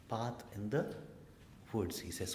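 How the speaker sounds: ordinary talk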